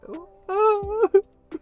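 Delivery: high, loud squeal of revulsion; acted squeamish high-pitched cry; chilled with disgust. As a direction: shudder out a grossed-out wail. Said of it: "have chills on his body and say".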